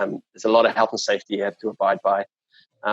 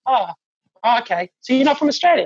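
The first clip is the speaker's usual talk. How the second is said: very high, hammed-up clueless customer; mock customer; 13 semitones higher than usual. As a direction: squeak it like a dopey punter. Customer still confused.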